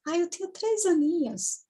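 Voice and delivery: put-on high little three-year-old; imitated toddler's reply; childlike voice. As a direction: answer in a small, high child's voice. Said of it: "de criança, uma voz bem infantil".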